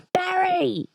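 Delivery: broad Aussie traveller calling out, high-pitched; Australian accent. Acted. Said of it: "you haven't heard an Australian accent".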